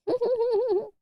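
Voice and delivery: short high giggle; high laugh. Real, not acted. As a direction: let out a bright little laugh. Laugh at the name.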